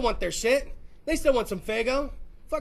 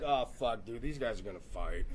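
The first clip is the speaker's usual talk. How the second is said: low, soft muttered warning; hushed aside; 12 semitones lower, 10 dB quieter than usual. Retold or imitated. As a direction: say it under your breath. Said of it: "you're like".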